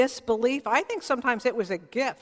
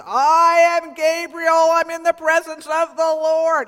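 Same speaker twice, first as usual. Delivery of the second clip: booming angel; 6 semitones higher, 10 dB louder than usual. Retold or imitated. Angel declares himself.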